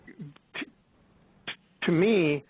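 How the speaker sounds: ordinary talk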